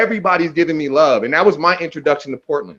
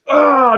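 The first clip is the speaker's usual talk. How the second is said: high open-mouthed holler; imitated yell; 11 semitones higher, 4 dB louder than usual. Rapper pictured destroying his opponent, imitated yell. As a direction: mimic a shout caught mid-rant. Imitating himself captured mid-speech.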